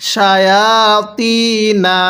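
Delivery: slow chanted শায়াতিনা with heavy Arabic t; heavy "to" sound. Shown as a demonstration. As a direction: draw it out, t full, not thin "ta". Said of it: "তকে তই উচ্চারণ করতে হবে". (টেনে শায়াতিনা, ত মোটা করে)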